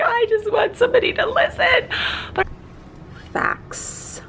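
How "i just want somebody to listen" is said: high-pitched imitation of a tearful plea; pleading to be heard, whiny and high; needy plea. Venting to mom, mock tearful high plea. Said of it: "you were just like".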